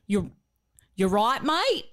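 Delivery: Bogan accent. Shown as a demonstration. Demonstrating for the co-hosts on request, Bogan accent. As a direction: put on a broad Bogan Australian accent. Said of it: "speak it for us".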